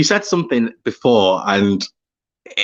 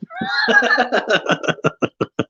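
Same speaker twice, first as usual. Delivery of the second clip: high-pitched run of laughter; sustained, high cackling laugh; long giggling laugh. Real, not acted. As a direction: laugh at your own joke. Laughs after offering the ringtone.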